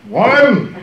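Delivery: loud, high mock summons; hammed-up domestic command; bossy husband's call. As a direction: call your wife to order, mock-imperious. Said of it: "in my house, if I said".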